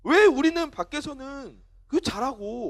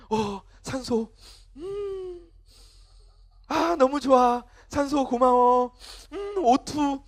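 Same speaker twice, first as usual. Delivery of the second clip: slow, savouring thanks to oxygen; mock gratitude; 3 semitones higher, much slower than usual. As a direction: act blissfully grateful with drawn-out words. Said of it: "산소한테 감사하면서 살진 않잖아".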